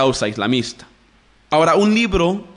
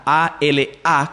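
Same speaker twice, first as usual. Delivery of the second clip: slow letter-by-letter A L A; spelled out; slower than usual. Shown as a demonstration. Teacher spells the word for listeners, spelled out. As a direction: spell A L A with a beat on each letter. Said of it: "con acento en la segunda A".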